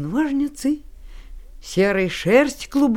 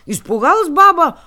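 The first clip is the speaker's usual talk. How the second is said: usual speaking voice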